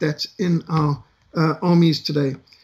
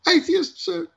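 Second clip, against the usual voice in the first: timid, high-pitched reply; a nervous recruit owning up, much higher; hesitant; 12 semitones higher, slower than usual. Retold or imitated. Recruit answering the sergeant major: hesitant high reply. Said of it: "said in a hesitant voice".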